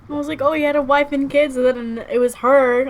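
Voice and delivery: loud, high emotional recap; high-pitched, dismayed outburst; upset disbelief. Real, not acted. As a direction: blurt it out loudly, overwhelmed by the twist.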